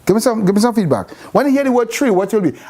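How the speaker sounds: ordinary talk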